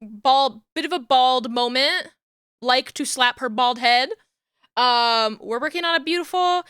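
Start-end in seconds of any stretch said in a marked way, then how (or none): none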